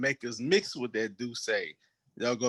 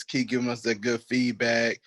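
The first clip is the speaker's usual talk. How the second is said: ordinary talk, nothing marked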